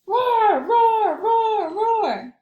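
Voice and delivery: high, loud cartoon roar; playful monster roar. Leonardo the terrible monster roars, loud playful roar. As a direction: roar like a silly friendly monster.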